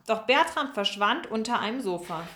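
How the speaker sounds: usual speaking voice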